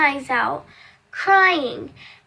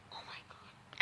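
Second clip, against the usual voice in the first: soft gasping whisper; 23 dB quieter than usual. Retold or imitated. Onlookers at the clinic stare at her foot, whispering. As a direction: murmur it in shock.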